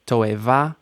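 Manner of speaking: toeva with weight on the last syllable; final stress. Shown as a demonstration. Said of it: "Final stress".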